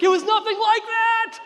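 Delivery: high slow traumatized yell; anguished scream. Acted. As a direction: shriek it as a kid reliving trauma. Traumatized kid screams at Jungle Book.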